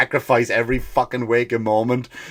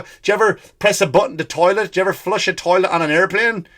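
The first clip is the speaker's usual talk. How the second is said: ordinary talk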